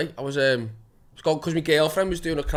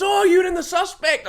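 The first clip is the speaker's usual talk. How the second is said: high, loud, drawn-out exclamation; excited recognition; 7 dB louder, slower than usual. Retold or imitated. Woman recognising an actor on the platform, excited. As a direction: imitate a thrilled fan, high and slow. Fan recognising him, acted.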